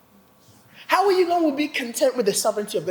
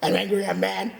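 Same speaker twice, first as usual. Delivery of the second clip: mild retold anger; 6 semitones lower, 5 dB quieter than usual. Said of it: "I was like".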